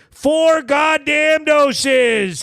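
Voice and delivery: high, slow, stretched-out warning; comic hollered emphasis; emphatic holler. Real, not acted. Repeats his warning with emphasis.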